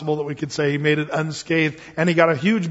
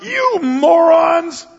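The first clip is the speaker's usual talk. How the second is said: high, loud, slow furious shout; angry yell; 11 semitones higher, 6 dB louder, much slower than usual. Retold or imitated. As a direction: blast it at the crowd in a rage. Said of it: "he yells out".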